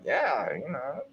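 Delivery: a hint of mellow satisfaction; content and easy. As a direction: savour it quietly, pleased with yourself. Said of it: "someone can call me Uncle Tom and be like".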